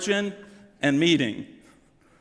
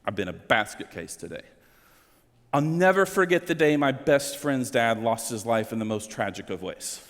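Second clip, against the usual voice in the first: choked up; 8 semitones lower than usual. Real, not acted. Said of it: "I'm getting emotional".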